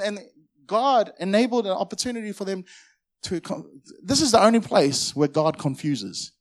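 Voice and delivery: ordinary talk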